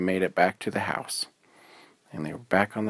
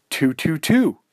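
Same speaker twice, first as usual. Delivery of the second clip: amazed; 8 semitones higher, 7 dB louder, slower than usual. Acted. Noticing the clock.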